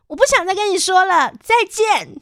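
high, loud, haughty snub; haughty goodbye. Retold or imitated. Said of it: "很傲娇的跟他说". (傲娇地说再见)

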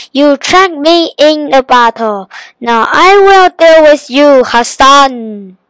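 loud, angry genie shout; thundering genie. A narrator's reading. The genie threatens Hassan for trapping him, in a thundering shout. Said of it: "His voice sounded like thunder".

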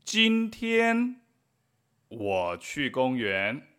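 careful slow Chinese for learners; slow model sentences. Shown as a demonstration. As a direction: read each example slowly and clearly. Model sentences read slowly for learners. (lectura lenta y clara de ejemplos)

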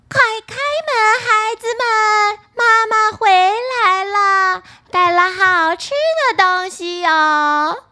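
put-on wolf sweetening his voice up high to trick the kids; a wolf's sugary falsetto, drawn out; wolf faking a thin voice. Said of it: "声音变得细了以后".

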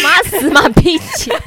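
slow teasing through laughter; mock the suck-up, laughing; laughing tease. Real, not acted.

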